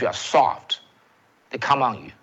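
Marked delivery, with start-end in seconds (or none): none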